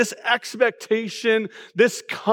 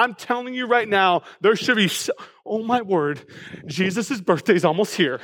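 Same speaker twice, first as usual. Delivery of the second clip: ordinary talk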